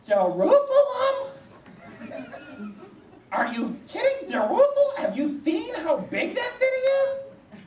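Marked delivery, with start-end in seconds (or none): 0.1-1.3 very high, drawn-out Jerusalem, louder; shocked squeak. Acted. Hearing God say Jerusalem: high shocked squeal. 4.3-7.6 shocked squeak. Acted. Hearing God say Jerusalem: high shocked squeal.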